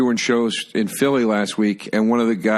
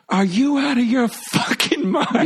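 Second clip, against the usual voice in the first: high, slow, indignant squeal; imagined outraged Jessica Lange; slower than usual. Retold or imitated. Jessica Lange's inner voice, outraged by the reporter. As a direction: shriek it in mock outrage at the insult. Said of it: "in her head, she's like".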